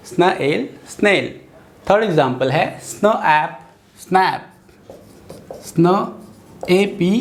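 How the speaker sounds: usual speaking voice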